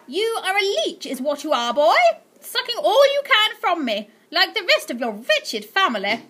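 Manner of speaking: high, loud, contemptuous scolding; scornful aunt. Acted. Aunt Greta berating her nephew, high and scornful. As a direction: spit the insult at him.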